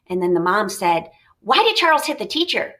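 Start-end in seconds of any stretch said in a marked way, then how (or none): 1.4-2.7 mom asking quickly. Acted. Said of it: "I asked quickly".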